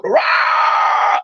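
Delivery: long, harsh creature roar; imitated monster roar. Storyteller voicing the creature as it charges, imitated roar. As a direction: let out a beast's roar. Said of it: "it goes".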